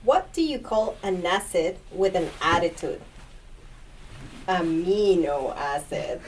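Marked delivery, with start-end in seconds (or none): 4.5-6.2 slow punchline breaking into laughter; laughing punchline. Real, not acted. Doctor delivering his own pun, laughing.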